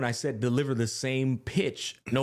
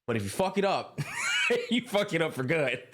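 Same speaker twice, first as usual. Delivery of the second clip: raised, laughing warning; half-laughing mock threat; 8 semitones higher than usual. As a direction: push it up with a laugh, jokingly threatening. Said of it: "like, say".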